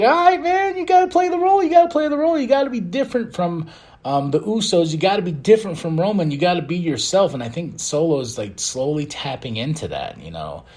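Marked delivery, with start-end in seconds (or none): 0.0-7.3 high, pushy mentor voice, pitched way up; mock pep talk. Speaker coaching Solo to play his role, high mock pep talk. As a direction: hype him up like a coach in a squeaky voice.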